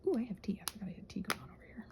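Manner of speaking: ordinary talk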